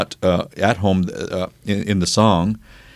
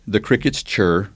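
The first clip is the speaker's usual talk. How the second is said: ordinary talk, nothing marked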